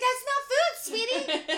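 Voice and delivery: high, loud comic plea; mock screaming. Woman scolding a raccoon over the linen, high mock scream. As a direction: scold an animal like a naughty child. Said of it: "clapping loudly at him and screaming".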